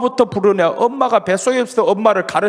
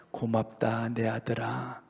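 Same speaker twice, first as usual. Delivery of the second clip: much softer, slow, deeply tender; moved Lord's soft thanks; 7 semitones lower, 14 dB quieter, slower than usual. Retold or imitated. The Lord thanking his son, soft and moved. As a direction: thank him softly, almost breaking into a whisper. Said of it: "주님이 이 안에서 짠하게 생각하십니다".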